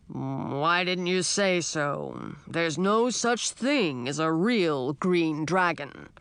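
put-on slow, rumbling old dragon; a grand old dragon, taking his time; old dragon king.

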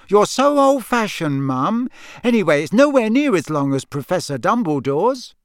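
put-on a teenage daughter siding with her brother; young girl. Ginny voiced in story.

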